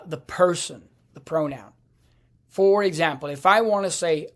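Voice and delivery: ordinary talk, nothing marked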